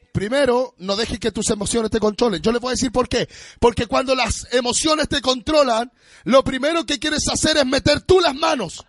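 rising preaching. Acted. Pastor warning the church about emotions, climbing and sung. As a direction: build the sermon point to a peak.

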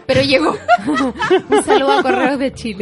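laughing. Real, not acted. Laughing at the late mail.

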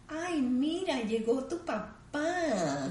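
put-on high, slow cooing to the baby; mother's baby voice. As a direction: announce daddy to the baby in motherese. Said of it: "la mamá va a decir". (mamá le anuncia al bebé que llegó papá, con voz aguda)

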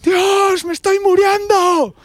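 very high, drawn-out wail; hammed-up scream of a friend in pain; mock agonised cry. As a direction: cry out to God as if dying. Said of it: "Pero qué hijos de puta".

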